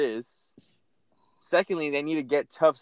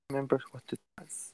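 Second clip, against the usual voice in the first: whispered to herself, trying to recall; 8 dB quieter than usual. Real, not acted. Whispering to herself while trying to recall what it was. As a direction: breathe it out, half to herself.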